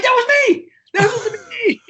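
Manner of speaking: very high, loud outburst; mock outrage. Drummer learning the other guy got off free, mock outrage. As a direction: cry out at the injustice, playing it up. Comic outrage at swapped fates.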